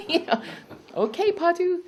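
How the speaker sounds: high laughing reply; amused, laughing it off. Real, not acted. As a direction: laugh through the words. Laughing at cousin's remark.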